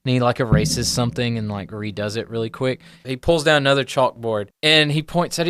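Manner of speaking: ordinary talk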